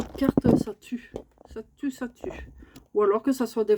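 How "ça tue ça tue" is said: soft unvoiced whisper of the words; hushed muttered echo; whispered repeat. Real, not acted. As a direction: whisper it to yourself.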